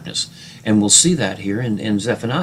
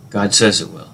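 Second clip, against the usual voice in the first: ordinary talk, nothing marked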